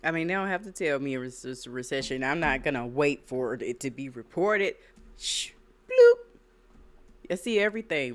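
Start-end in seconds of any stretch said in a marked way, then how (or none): 5.2-7.3 high squeaky mock sound effect; cartoon bloop noise; squeaky shh bloop effect. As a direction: pop out a tiny cartoon bloop, very high and loud. Sound effect mid rant.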